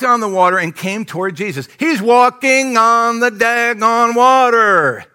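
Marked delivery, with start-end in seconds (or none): 1.8-5.0 loud, high, drawn-out exclamation; preacher shouting in wonder; amazed shout. Real, not acted. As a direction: stretch every word in booming amazement. Preacher marvels at the miracle.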